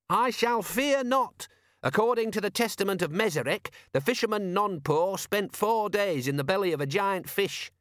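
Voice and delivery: high-pitched, preachy zealot voice; pious constable. Acted. Constable Visit quoting scripture to shipmates, high pious voice.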